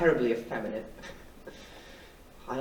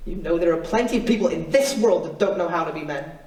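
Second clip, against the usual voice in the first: louder, higher, impassioned self-defence; defiant assertion; 5 semitones higher, 7 dB louder than usual. Acted. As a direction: push it out with defiance. Defending himself against shame.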